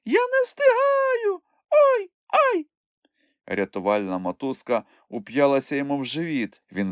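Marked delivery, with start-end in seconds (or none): 0.0-2.7 very high, drawn-out cry for help; squeaky yelling. A narrator's reading. Chmykh dragged behind his friends, squeaky hollering. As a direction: wail in falsetto, ending in yelps. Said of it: "горлав Чмих".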